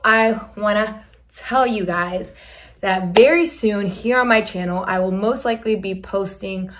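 normal talking voice

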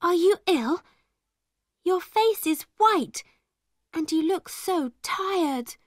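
high, anxious questioning; worried. A narrator's reading. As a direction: fret over her pale friend. Friend worried by pale Zara.

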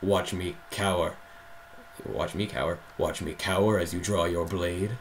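low, soft shadowy villain; quiet, deep villain read; shadow voice. Acted. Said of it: "Do your shadow voice".

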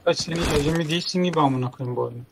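ordinary delivery

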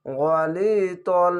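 drawn out recitation, each syllable held; teacher reciting slowly to students; slow Quran recitation. Shown as a demonstration. Teacher demonstrating recitation slowly for the class. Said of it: "এক আলিফ টেনে পড়বেন".

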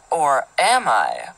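slow, low teasing menace; mock sinister. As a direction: draw it out like a playful villain. Mock-sinister twist on creep remark.

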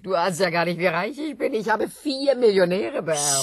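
loud, high bragging; boastful. Acted. Woman bragging to John about four inherited fortunes. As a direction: crow about the riches.

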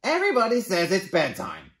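high, loud complaining bird; whiny bird voice. Acted. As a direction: whine about bedtime. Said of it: "says Bird".

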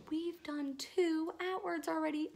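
slow hushed whisper of amazement; awed whisper. Acted. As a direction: whisper it like a secret wonder. Awed whisper after gasp.

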